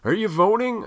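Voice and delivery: high, loud nosy question; mocking people who ask about voting, high and loud; nosy asker. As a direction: chirp it like a pushy canvasser. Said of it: "I hate when people ask me that".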